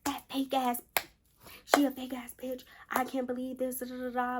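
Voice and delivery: half-acted fuming friend; mock rant. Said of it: "We going off, y'all".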